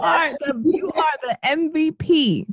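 high, loud cheering; cheering shout-out. Real, not acted. Host shouting out to the guest's husband, cheering. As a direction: hype him up like a crowd.

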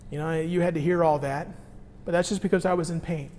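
put-on soft, quiet apology to a child; father softly explaining to his son; gentle apology. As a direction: tell your son gently it wasn't him. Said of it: "I could just go to him and say".

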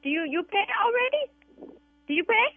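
put-on broken English, Vietnamese accent. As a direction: prank call cleaner, Vietnamese accent.

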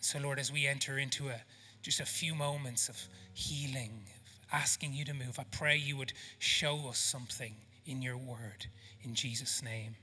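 soft, low, unhurried prayer; hushed pastoral prayer with pauses. Real, not acted. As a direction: pray gently over the room, letting phrases settle. Leads the congregation in prayer.

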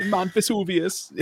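high, slow mock Italian accent; mimicked Italian accent. Co-host teasing the host's Mount Vesuvius line, mock Italian accent. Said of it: "sounding like an Italian Jamaican".

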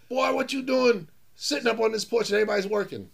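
high, loud scolding farmer. Retold or imitated. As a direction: play the farmer calling him out as a lazy city boy. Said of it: "he said".

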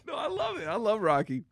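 normal talking voice

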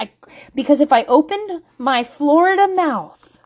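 normal talking voice

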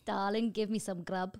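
slow mock-Cockney demand; Cockney accent. Wife imitating her man demanding food, Cockney accent. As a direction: drag it out like a Cockney husband. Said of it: "more like of a Cockney".

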